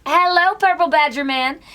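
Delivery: ordinary talk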